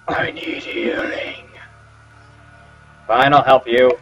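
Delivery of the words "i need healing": slow creepy whispered rasp. Retold or imitated. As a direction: whisper it like a ghoul. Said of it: "That was a very creepy voice".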